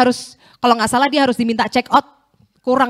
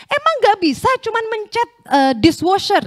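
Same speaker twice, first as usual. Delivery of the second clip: mock scolding, pitched much higher; retold angry yell; 11 semitones higher than usual. Angry old cleaner to the pastor about the dishwasher, mock yell. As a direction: imitate an irate man yelling, without real volume. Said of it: "Dia teriakin juga gitu".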